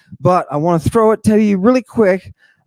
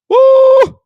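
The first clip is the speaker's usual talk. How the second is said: very high, loud, sustained whoop; mock cheer; 7 dB louder than usual. Abel cheers the sacrifice, high whoop. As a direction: let out a piercing mock-gleeful woo.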